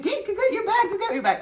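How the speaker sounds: high, loud mock panic; frantic mom. Mom orders daughter to get bag, squealing. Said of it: "I was like".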